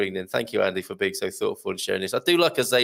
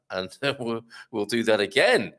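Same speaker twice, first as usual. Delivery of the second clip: ordinary delivery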